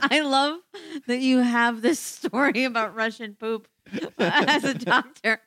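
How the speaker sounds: speaking through laughter; laughing. Real, not acted. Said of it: "laughing while talking".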